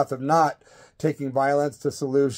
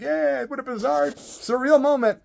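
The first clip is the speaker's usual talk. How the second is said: mock cheer; 9 semitones higher than usual. Crowd cheering after the song.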